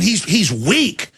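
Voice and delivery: ordinary delivery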